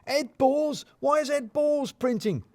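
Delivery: high, startled outburst from a boss; startled shout. Acted. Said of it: "to shouts of".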